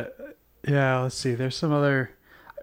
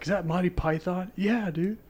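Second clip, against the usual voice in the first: higher-pitched mock fan voice; imitated fan; 6 semitones higher than usual. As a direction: put on a high dude voice. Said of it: "I don't talk like that".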